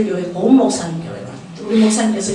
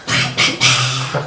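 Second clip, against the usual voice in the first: low, slow "팍팍팍" spitting sounds; mock the preacher's spit flying, slow and low; imitated spit spray; 12 semitones lower, much slower than usual. Telling the congregation how the pastor spat, slow low spitting noise.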